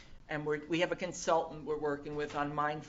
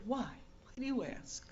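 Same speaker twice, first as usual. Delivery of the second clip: soft nun's question; 7 semitones higher, 7 dB quieter than usual. Retold or imitated. Nun asks softly, accented.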